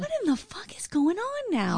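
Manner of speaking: high incredulous outburst; what on earth, disbelieving; outraged disbelief. Real, not acted. Outraged reaction.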